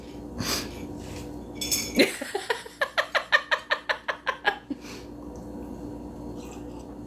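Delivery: long, high, airy giggle; a drawn-out, breathy cackle at the innuendo. Real, not acted.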